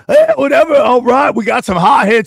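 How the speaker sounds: loud, high hype radio DJ; seventies DJ voice. Acted. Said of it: "like a D J from the seventies".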